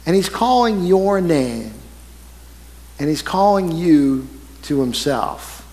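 hushed pulpit call to follow; solemn sermon appeal. Acted. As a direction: draw the listener in gently. Slow solemn preaching appeal.

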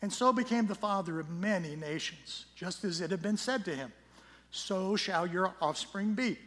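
solemn public Bible reading; scripture reading. Acted. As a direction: proclaim the passage with weight. Reads scripture passage aloud.

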